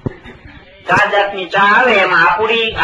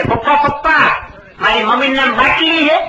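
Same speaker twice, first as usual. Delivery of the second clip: lightly voiced little boy tugging at dad; child's voice; 7 semitones higher than usual. Boy tells his father, in a lightly voiced child's tone. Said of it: "છોકરાએ કીધું ને પપ્પાને".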